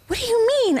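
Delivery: squeaky high disbelief; high incredulous quote. Retold or imitated. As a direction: play the friend high and puzzled. Friend's quoted reply.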